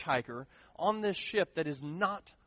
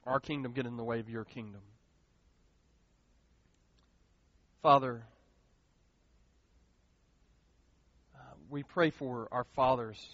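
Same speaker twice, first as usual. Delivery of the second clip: prayer; 6 semitones lower than usual. Acted. Leads congregation in prayer.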